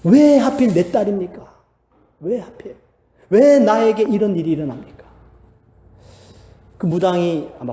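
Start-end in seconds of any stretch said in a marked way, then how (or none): 0.0-5.0 pleading lament. Retold or imitated. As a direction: cry out why to the shaman, begging. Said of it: "하소연하듯이 물어봅니다".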